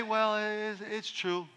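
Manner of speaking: soft, unsure half-statement; a limp, doubtful "true"; hesitant. Shown as a demonstration. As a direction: say it without conviction. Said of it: "And if you say".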